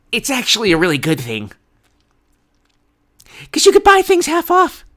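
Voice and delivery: hammed-up upbeat economist pitch; liberal economist. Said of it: "liberal Democrat who happens to be an economist says".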